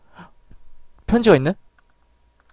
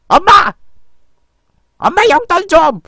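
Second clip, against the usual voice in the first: put-on much higher whiny kid voice; childish call to mom; 11 semitones higher, 3 dB louder, slower than usual. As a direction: whine like a kid asking for money.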